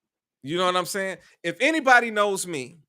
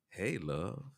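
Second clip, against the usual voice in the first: "Hey, love." said low and muttered, much softer; flat quiet greeting; 9 semitones lower, 15 dB quieter than usual. Shown as a demonstration. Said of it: "I'm not saying".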